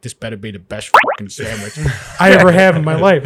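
lightly done Italian Chicago voice with a laugh; a hint of a tough Italian sandwich customer. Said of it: "this little Italian voice going in my head".

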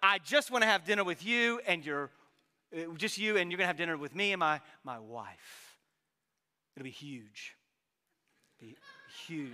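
mock Trump voice, sinking low on huge; hammed-up president on the phone; Trump impression. Preacher voicing the president inviting you to dinner, mock Trump. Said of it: "And he says".

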